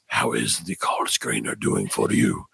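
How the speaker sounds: mock-menacing boss asking how the screener is doing; intimidating boss. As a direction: play the boss deep and looming. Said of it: "he's like".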